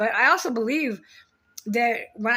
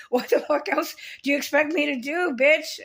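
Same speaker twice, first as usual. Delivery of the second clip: raised, exasperated challenge; indignant telling-off; 5 semitones higher than usual. Real, not acted. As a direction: fire it at the sister, pitch jumping up. Tells off sister in direct address.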